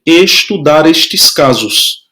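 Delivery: estudar estes casos, slowly, word by word; teacher dictating slowly to students; slow dictation. Shown as a demonstration. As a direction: dictate it slowly so they can write. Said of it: "Escreva aí no seu livro".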